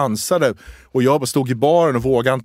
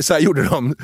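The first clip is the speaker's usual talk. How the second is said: ordinary talk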